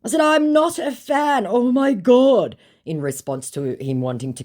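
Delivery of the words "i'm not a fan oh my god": high, loud, slow and emphatic; exasperated retort. Retold or imitated. Woman telling impostor she's not a fan, exasperated. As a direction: spell it out in exasperation. Retelling her exasperated reply.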